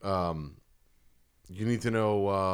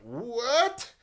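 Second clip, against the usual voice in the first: incredulous What; 11 semitones higher than usual. Retold or imitated. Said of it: "And I went".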